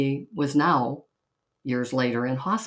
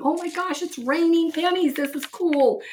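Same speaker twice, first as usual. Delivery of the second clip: much higher, thrilled crowd cry; excited shout. Retold or imitated. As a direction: squeal it like a delighted passenger. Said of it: "People were absolutely shouting and laughing".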